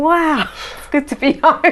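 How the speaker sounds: high-pitched, drawn-out delight; slow, gushing relief at hearing home. Retold or imitated. Returning traveller thinking aloud at the airport, delighted.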